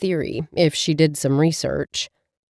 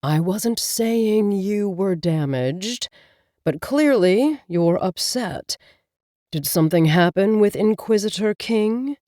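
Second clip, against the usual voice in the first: calm talking cat, a touch slower; talking cat Abel. Acted. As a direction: speak as the wise, patient cat familiar. Cat familiar Abel speaks.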